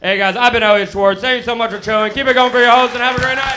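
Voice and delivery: loud, high announcer sign-off; stage sign-off. Real, not acted. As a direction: hype the crowd as you leave. Sign-off to crowd.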